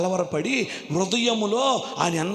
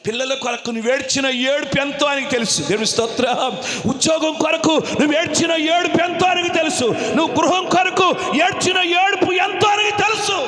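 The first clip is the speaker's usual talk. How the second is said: rising, loud sermon cadence; full-force preaching; 9 semitones higher, 6 dB louder than usual. Real, not acted. Preacher assuring weeping believers that God knows, impassioned. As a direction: build each repeated phrase higher, into a shout.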